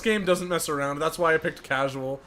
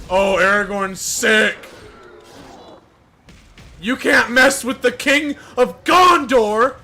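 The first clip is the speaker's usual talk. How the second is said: louder, slower excited praise; hyped; 4 semitones higher, 7 dB louder, slower than usual. Real, not acted. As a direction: hype it up slowly. Hyped about hero.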